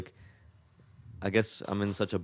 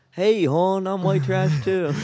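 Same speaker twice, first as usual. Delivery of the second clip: mock Baltimore hon; 9 semitones higher, 5 dB louder, slower than usual. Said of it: "I'm like".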